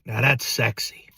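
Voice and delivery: low, slow seductive purr. Pretend. Host purrs about cheesy corn, low and slow.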